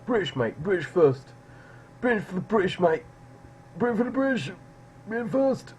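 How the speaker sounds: high, loud British nationalist caricature; mock British nationalist. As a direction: rant it as a patriotic British lout. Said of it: "He's got the British flag".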